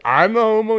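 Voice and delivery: loud, high incredulous comeback; mock indignant. Friend hurling the insult back in banter, mock indignant. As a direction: throw it back as an outraged question.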